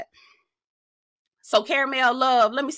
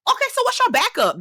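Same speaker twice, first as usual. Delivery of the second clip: high, sharp challenge; incredulous; 3 dB louder than usual. Real, not acted. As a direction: demand an answer, sky high.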